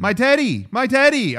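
high, loud mock exclamation, repeated; mimicked kid cry. Cohost mocking the kid who can't say teddy bear, mimicked cry.

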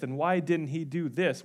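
normal talking voice

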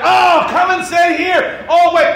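high, gushing greeting, drawn out; mock fawning welcome. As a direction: overdo the welcome for the rich visitor. Fawning over rich churchgoer.

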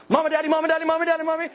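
high, rapid wail for Mama and Daddy; mock a terrified kid crying out for any parent; frantic high-pitched chant, hammed-up; imitated panicked child. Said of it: "didn't know who to cry out to".

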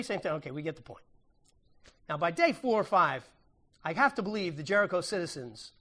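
ordinary talk

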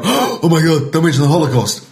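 sharp gasp, then high alarm; hammed-up scandalised reaction; mock shock.

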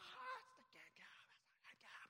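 whispered scream of excitement; hushed, breathy pretend shrieking; mock freak-out. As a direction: imitate kids squealing, all on breath. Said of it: "they start freaking out".

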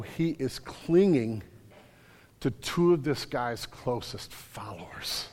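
low unvoiced whisper; whispered aside. Preacher hushing as the healed man clings to disciples, whispered. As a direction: drop to a whisper. Hushed storytelling dip.

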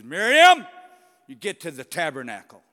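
booming high call of the name, then a firm command; thundering divine summons; God's stern summons. Acted. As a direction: call her out like an angry God. God summons Miriam sharply.

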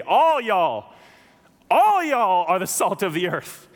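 All y'all, punched loud in Southern drawl; serious Texan. Retold or imitated. Pastor joking to the church, mock Texan emphasis. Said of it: "when you're in Texas and you get really serious".

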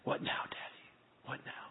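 whispered, trusting little question; hushed child question. Retold or imitated. Both sons grabbing dad's fingers, whispered trusting question. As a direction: breathe it softly, holding his fingers.

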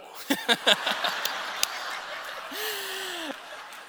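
high burst of laughter; real laugh. Real, not acted. Pastor laughs at son's prayer, real laughter. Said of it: "And I was like".

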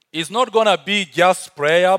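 ordinary talk, nothing marked

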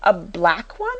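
timid, puzzled echo rising at the end; bewildered stammer. A narrator's reading. Timid sister questioning the schoolmistress, bewildered. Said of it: "Miss Amelia stammered again".

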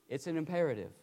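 ordinary delivery

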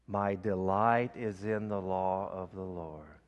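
slow, drawn-out prayer on one breath. Shown as a demonstration. Preacher leading the congregation in a slow breath prayer. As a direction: breathe the words out slowly. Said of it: "Do that with me".

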